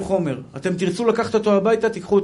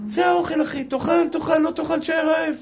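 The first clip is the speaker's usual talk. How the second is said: pitched high, a caretaker who doesn't care whether you eat; mock boarding school server, pitched higher, take it or leave it; gruff cook at the dining hall; 8 semitones higher than usual. Boarding school staff serving food.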